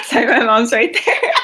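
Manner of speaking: real laughter breaking into the words; laughing apology. Real, not acted. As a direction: apologize while cracking up. Player cracks up mid-scene.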